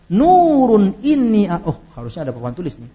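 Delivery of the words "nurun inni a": slow recited "Nurun inni a-"; recited Arabic reading. Shown as a demonstration. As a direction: chant "Nurun inni a-" slowly, higher. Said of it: "dua riwayat berbeda".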